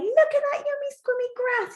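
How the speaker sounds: a goat thrilled by yummy grass; excited goat. Shown as a demonstration. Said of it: "this goat is really excited".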